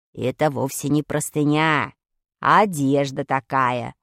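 very low, slow character voice; explaining Vadik. A narrator's reading. Vadik correcting Pashka about the sheet, low explanation. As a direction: explain it deep and measured. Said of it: "пояснил Вадик".

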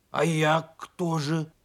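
slow, puzzled creature voice; surprised creature voice. A narrator's reading. Donkey asking bear cub who he is, surprised. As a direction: ask it slowly, taken aback. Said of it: "удивился ослик".

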